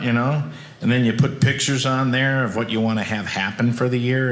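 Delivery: normal talking voice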